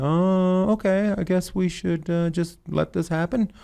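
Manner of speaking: high, sing-song fake acceptance; mock resigned. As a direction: pretend to give up, sarcastically. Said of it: "And I'm like".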